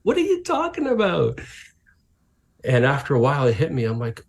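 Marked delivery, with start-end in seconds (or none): 0.0-1.4 baffled. Retold or imitated. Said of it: "And he's like".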